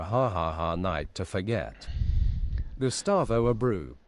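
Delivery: ordinary talk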